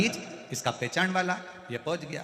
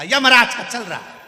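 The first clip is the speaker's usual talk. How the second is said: loud, higher, angry snap at the visitor; angry retort; 9 semitones higher, 15 dB louder than usual. Retold or imitated. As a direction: throw back the answer in a rage. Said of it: "अब इसको आ गई गुस्सा".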